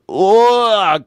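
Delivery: loud, high drawn-out groan; exasperated groan. Acted. Comedian reacting to dad's grating accent, exasperated groan. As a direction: groan as if it hurts to hear.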